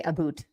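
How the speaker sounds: "about" said as "aboot". Shown as a demonstration. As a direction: make "about" rhyme with "boot". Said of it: "people think that we say".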